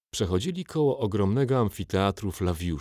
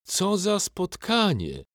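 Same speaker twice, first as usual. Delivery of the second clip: higher, slow, falsely delighted greeting; booming mock welcome; mock-hearty; 12 semitones higher, slower than usual. A narrator's reading. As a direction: savour the awkward reunion. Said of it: "zagrzmiał głos Fortunatusa".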